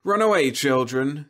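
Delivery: casual brush-off; nonchalant. A narrator's reading. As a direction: breezily shoo the children off. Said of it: "she said nonchalantly".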